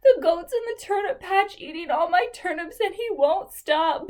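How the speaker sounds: lightly voiced whining little boy; little boy telling the bobcat about the goat. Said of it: "the little boy said".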